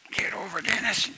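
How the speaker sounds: a high, breathless whisper; sleepy whisper. Retold or imitated. Sleepwalking brother whispering to move over in bed. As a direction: hush it like a half-asleep brother.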